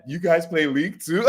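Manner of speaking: high, giggling question; amused mock gamer; laughing. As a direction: ask through laughter. Joking as the character, laughing.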